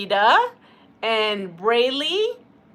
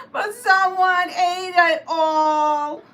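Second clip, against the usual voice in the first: high, loud, slow mock sob; crying voice; 8 semitones higher, 5 dB louder, slower than usual. A narrator's reading. Baby Bear finding his bowl empty, mock sobbing. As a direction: wail it like a heartbroken cub. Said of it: "in a crying voice".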